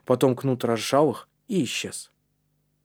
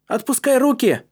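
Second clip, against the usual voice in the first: high, loud order; urgent, raised-voice command; shouted command; 12 semitones higher, 5 dB louder than usual. A narrator's reading. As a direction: call out the order sharply. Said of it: "скомандовал Калиб".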